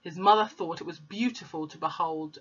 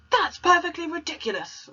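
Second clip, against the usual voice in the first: high, scornful headmistress voice; snorting Trunchbull; 12 semitones higher, 5 dB louder than usual. Acted. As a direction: sneer it out as the Trunchbull. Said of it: "snorted Trunchbull".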